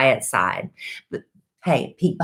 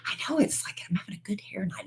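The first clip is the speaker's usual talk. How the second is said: whispering; 5 semitones higher, 12 dB quieter than usual. Real, not acted. Whispered aside at start.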